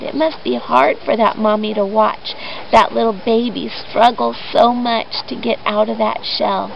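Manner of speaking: ordinary delivery, nothing marked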